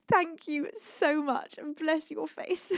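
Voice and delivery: slow, near-tearful thanks; choked-up gratitude; emotional thanks. Real, not acted. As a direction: let the voice catch with feeling. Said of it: "I'm getting so emotional".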